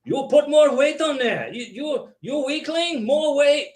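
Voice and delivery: high-pitched mock Austrian gym bully; hammed-up bodybuilder ordering people around; Arnold Schwarzenegger. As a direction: goad a weakling in an imitated Austrian accent. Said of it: "you know, poking people".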